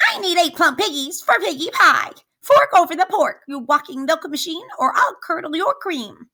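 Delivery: high, fast, demanding witch voice; angry witch. Acted. Witch threatening the cow for her pigs, high and fast. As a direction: rattle off the threat.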